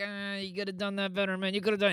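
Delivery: high, thin self-scolding voice; mock your own playing from way up high; nagging inner critic. Bassist mimicking his inner critic after a take, high and nagging. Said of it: "where I'm like".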